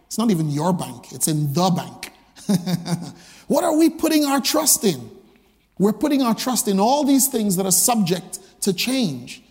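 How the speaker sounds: ordinary delivery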